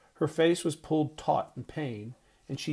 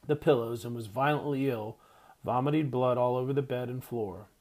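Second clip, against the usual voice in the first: usual speaking voice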